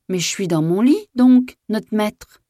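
high, loud, drawn-out protest; calling back. A narrator's reading. Maid in bed answering her master, calling back. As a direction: call back high, stretching the end.

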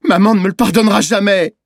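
high, loud despairing wail; child's shout. A narrator's reading. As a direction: cry out in despair about mother. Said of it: "il a crié". (cri aigu et désespéré d'enfant)

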